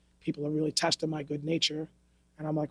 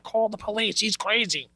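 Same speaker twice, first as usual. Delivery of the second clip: mock panicked bystander; 5 semitones higher, 3 dB louder than usual. Mocking people who cry for police.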